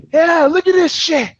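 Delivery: put-on high, loud Scottish accent, indignant; mock Scottish rant. Character ranting in a field, mock Scottish outrage. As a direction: imitate a fed-up Scot ranting. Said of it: "he goes on this great rant".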